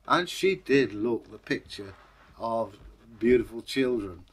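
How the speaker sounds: usual speaking voice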